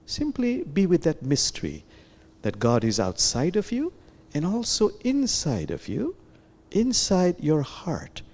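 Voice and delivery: gentle guided meditation voice. Acted. Teacher guiding listeners inward, in gentle meditation voice. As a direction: lead them inward, calmly.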